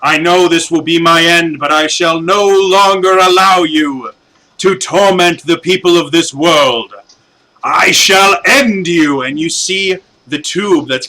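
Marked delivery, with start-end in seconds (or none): 0.0-9.2 louder, slow and grave proclamation; a doomed guardian declaring his last stand; solemn hero's defiance. Acted. Zordwin defies Bandora before sacrificing himself, slow grave loud proclamation. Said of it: "And he goes".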